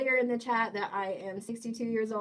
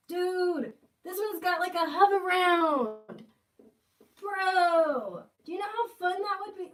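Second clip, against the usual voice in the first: excited squeal; 8 semitones higher, 6 dB louder, slower than usual. Real, not acted. Gushing over a toy hover round.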